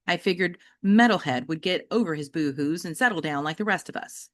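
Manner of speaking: usual speaking voice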